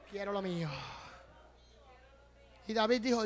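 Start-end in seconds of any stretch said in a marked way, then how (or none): 0.1-0.7 very soft, falling after a shout; hushed declaration. Acted. Preacher voices David claiming what is his, hushed. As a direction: drop suddenly to a hush.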